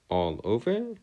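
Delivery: ordinary talk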